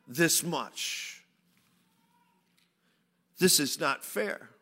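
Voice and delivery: dropping low and soft, defeated; quiet lament. Acted. Preacher voicing the people giving up on God, softly. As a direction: let the complaint sink into despair. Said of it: "What they were saying was".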